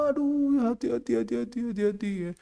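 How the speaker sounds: high, soft, fretting parent voice; mocking soft parent. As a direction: fuss over the child gently. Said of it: "Orang tuanya terlalu lembek". (mengejek orang tua lembek, tinggi dan lembut)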